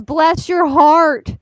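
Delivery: loud, drawn-out mock sympathy; Southern woman. Southern woman privately calling someone a dumbass, stretched mock sympathy. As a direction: stretch it out like a Southern belle's veiled insult. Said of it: "if a Southern woman says".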